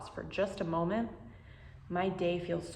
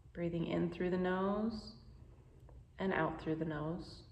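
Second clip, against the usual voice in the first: guided meditation; 5 dB quieter than usual. Acted. Teacher guiding listeners through a pause practice, calm and unhurried. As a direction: ease the listener into calm, one breath at a time.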